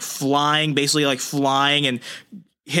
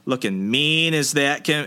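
usual speaking voice

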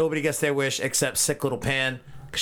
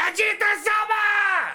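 much higher, loud, drawn out; reverent shout; 4 dB louder, slower than usual. Pretend. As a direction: cry out his name in a slow, high, loud shout. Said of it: "You're right, Vegeta-sama".

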